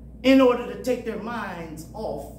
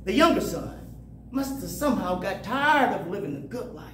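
ordinary talk

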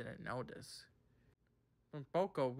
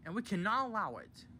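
higher-pitched indignant refusal; 6 semitones higher, 4 dB louder, faster than usual. A narrator's reading. Toby at the indignation meeting, indignantly. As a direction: put your foot down indignantly. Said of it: "had an indignation meeting".

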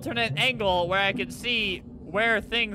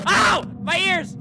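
loud, high cry of pain; sudden high-pitched outcry; pained yelp; 7 semitones higher, 5 dB louder than usual. Acted. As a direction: yelp as if the noise hurts. Noise hurts player's ears.

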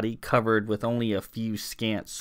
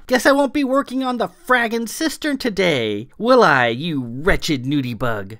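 much higher and louder, a mocking yell; jeering yell; 8 semitones higher, 6 dB louder than usual. A narrator's reading. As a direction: hurl the insult up high and loud. Clara jeering at the servant.

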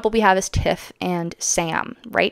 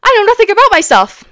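high, loud mock lost-soul voice; mock Ray; 11 semitones higher, 7 dB louder than usual. Ray mocked as having no idea who she is. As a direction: whine it high like a clueless Ray. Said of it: "Ray's like".

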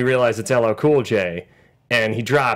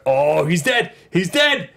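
alarmed; 3 semitones higher, slower than usual. Retold or imitated. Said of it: "I was like".

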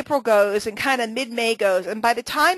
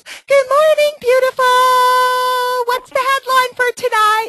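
much higher and louder sing-song mock Kathy; imitating Kathy; 14 semitones higher, 9 dB louder than usual. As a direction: chirp it way up like a cheery caricature. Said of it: "Kathy doesn't sound like that".

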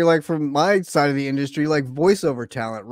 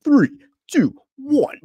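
imitating the boss's countdown; 11 semitones higher, 5 dB louder, slower than usual. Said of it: "it was so funny listening to him".

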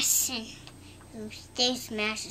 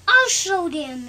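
loud, higher vow; determined vow; 5 semitones higher, 8 dB louder than usual. Acted. Thomas vows to beat the engines, loud. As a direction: declare it boldly. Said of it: "he said".

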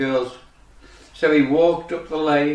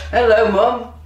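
high, louder rising hello; swan greeting Mum; 7 semitones higher, 5 dB louder, slower than usual. Acted. Grown duckling greets his mummy, high and eager. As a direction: call out hopefully to a parent.

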